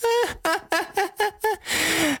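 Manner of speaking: high, rapid cackling laughter; hearty villain laugh. A narrator's reading. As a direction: throw your head back and cackle. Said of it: "孙天师仰天哈哈大笑". (仰天哈哈大笑，音调高亢)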